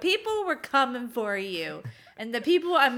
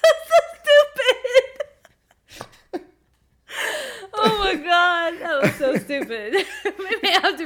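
high, loud laughing through words; laughing hard; 8 semitones higher, 7 dB louder than usual. Real, not acted. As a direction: crack up at the blunder. Laughing at their own blunder.

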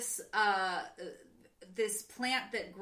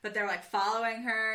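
ordinary delivery

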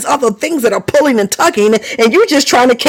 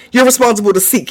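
ordinary talk, nothing marked

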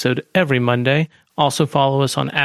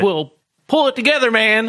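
much higher, fast and frantic; 8 semitones higher than usual. Acted. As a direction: insist in a high panic. Paranoid character insists.

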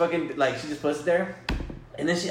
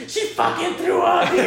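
retold yell; 13 semitones higher than usual. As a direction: shriek it like a grossed-out coworker. Coworker yelling about vomit.

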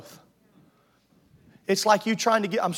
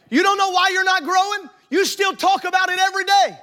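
higher, loud retort; preaching shout; 10 semitones higher, 6 dB louder than usual. Real, not acted. Preacher shouting back at the hiding member, loud and high.

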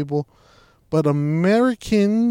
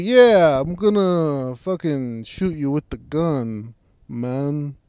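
loud, slow hammed-up Italian mobster accent; mock Italian accent; 4 dB louder, much slower than usual. Mocking a TV villain's Italian accent, loud and slow. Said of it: "most ridiculous, stereotypical Italian accent".

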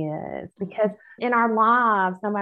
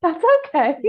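high, loud laugh through the words; 11 semitones higher, 7 dB louder than usual. Real, not acted. Guest laughing off the host's clumsy introduction, amused. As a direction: brush off the apology with a laugh.

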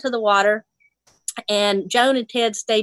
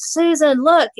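mock shout, a bit higher and louder; retold yell; 6 semitones higher, 4 dB louder, slower than usual. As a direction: call out to her across the river, half-acted. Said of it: "the fella yelled at me".

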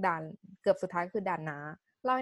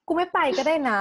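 higher, louder hurt outburst; dramatic sulk; 7 semitones higher, 6 dB louder than usual. Retold or imitated. Hurt by her boyfriend's flat tone, a loud dramatic huff. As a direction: fling it out like a wounded diva. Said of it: "ความดรามาติกมัน มันพุ่งขึ้นมา".

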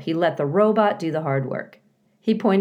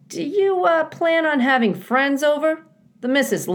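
high, sly beaver voice; a beaver fishing for gossip, pitched up; 11 semitones higher, 5 dB louder than usual. A narrator's reading.

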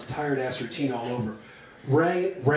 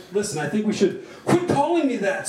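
higher, protesting raised voice; indignant yell; 6 semitones higher, 3 dB louder than usual. A narrator's reading. Actress objecting to a name, indignant yell. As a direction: object with pitch jumping up. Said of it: "Susie yelled".

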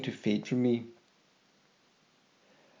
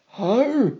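high, louder bewildered cry; 10 semitones higher, 4 dB louder than usual. Acted. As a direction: blurt it out, startled. Confused waking questions.

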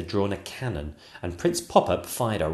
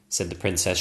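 ordinary delivery

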